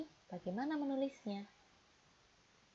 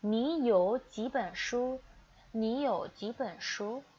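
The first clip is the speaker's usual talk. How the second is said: slow model reading; 5 dB louder, slower than usual. Shown as a demonstration. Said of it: "teacher models Mandarin phrase".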